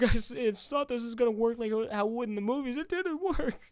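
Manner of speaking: high loud frantic panic; a kid freaking out inside; panicked inner voice. Retold or imitated. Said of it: "but inside I'm like".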